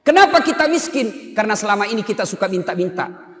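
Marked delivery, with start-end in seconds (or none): 0.0-1.4 preaching question. Acted.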